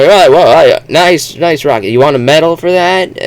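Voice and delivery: dry hammed-up sarcasm; sarcastic Martian. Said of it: "You say".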